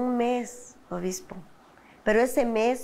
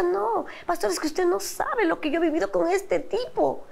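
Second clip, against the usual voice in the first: high, protesting objection; retold arguing; 9 semitones higher than usual. Wife arguing with the pastor about husband, high and exasperated. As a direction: push back heatedly in a high voice. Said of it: "Y yo peleaba con el pastor".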